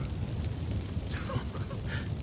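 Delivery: a short scornful laugh; mocking laugh. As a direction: laugh off the threat with contempt. Mocking the witcher's threat.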